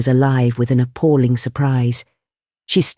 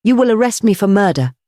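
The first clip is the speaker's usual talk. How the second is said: high, ringing declaration; raised, resounding statement; iron gong voice; 7 semitones higher, 3 dB louder than usual. A narrator's reading. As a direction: let it ring out like a gong. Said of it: "in a voice like an iron gong".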